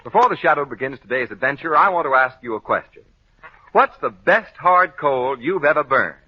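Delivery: ordinary talk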